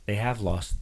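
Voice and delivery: ordinary talk, nothing marked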